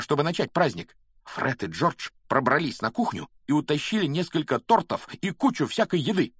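high, loud, breathless-with-news chatter; excited boasting. A narrator's reading. Ron telling Harry about the fight and party, excitedly. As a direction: gush the news to your friend.

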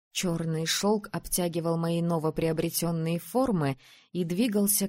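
ordinary delivery

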